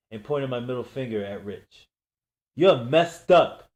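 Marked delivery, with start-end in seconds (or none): 2.5-3.7 loud, slow furious shout; yelling at the pranksters, furious; angry yell. Acted. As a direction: spit it out as you storm off. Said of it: "I yelled".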